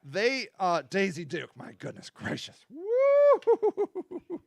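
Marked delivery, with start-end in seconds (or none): 1.6-2.6 breathless whisper. Real, not acted. Swooning over Daisy Duke. 2.7-4.4 excited whoop. Real, not acted. Swooning over Daisy Duke, a high excited whoop.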